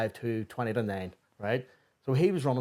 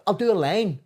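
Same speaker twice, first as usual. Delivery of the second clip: mock stern; 9 semitones higher, 5 dB louder than usual. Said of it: "And I said".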